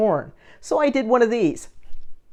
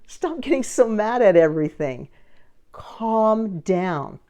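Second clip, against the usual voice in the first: ordinary talk, nothing marked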